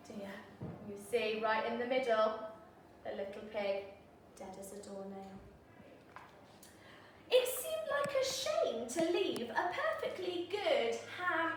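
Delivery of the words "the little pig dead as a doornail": soft, low, quick murmur; hushed. Acted. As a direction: drop to a hush. Repeats pig's death.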